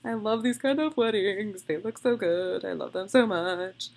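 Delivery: loud happy gushing over weddings; excited gushing. Retold or imitated. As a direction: gush like a thrilled bride. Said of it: "she was like".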